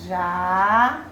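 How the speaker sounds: JÁ stretched out long on its own; syllable separated. Shown as a demonstration. Teacher splits the word for pupils, Já drawn out alone. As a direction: hold the a of Já.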